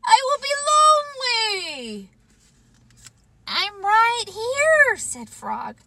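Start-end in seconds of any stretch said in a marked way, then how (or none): 0.0-2.1 very high, long falling wail; wailing shout. Acted. Toad fearing life without Frog, high wail. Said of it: "he shouted". 3.5-5.0 high, drawn-out answer; reassuring call. Acted. Frog answering Toad's cries at bedside, high drawn-out call.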